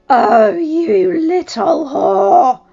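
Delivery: loud, high, slow furious cry; wife screaming at her husband's lover; enraged insult. Acted. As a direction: drag out the insult in rage.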